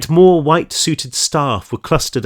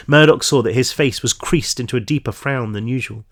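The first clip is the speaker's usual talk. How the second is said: plain reading voice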